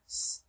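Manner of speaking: "s" as a bare hiss, not the letter name; isolated s sound. Shown as a demonstration. Teacher shows the sound ending rinse, isolated "s". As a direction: hiss "s" alone with no vowel. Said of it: "making the s sound".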